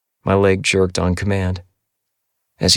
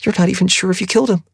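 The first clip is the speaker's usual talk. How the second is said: much higher, fast and pleading; pleading Addie; 12 semitones higher, much faster than usual. A narrator's reading. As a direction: rush it out, begging him. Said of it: "Her voice was pleading".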